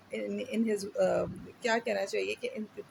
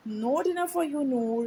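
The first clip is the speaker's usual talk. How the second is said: higher, clipped fatherly snap; father snapping; 5 semitones higher, much slower than usual. Acted. Father punishing his son, snapping at him. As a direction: bite the words off like a cross dad. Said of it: "snapped his father".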